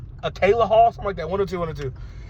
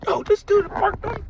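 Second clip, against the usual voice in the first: high, breathless exclamation; cracking up; 11 semitones higher, slower than usual. Real, not acted. Storyteller overcome at the dude getting thrown out, cracking up. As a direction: burst out high, barely getting words out.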